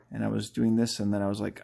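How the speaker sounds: ordinary talk, nothing marked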